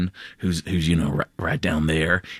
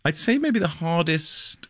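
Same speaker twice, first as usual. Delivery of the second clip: character voice; 10 semitones higher than usual. Shown as a demonstration. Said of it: "slips into a character voice".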